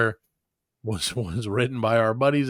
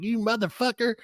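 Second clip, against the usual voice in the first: mock Southern accent; 8 semitones higher than usual. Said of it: "I say it with a real heavy Southern accent".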